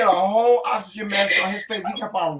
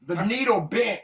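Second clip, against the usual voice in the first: usual speaking voice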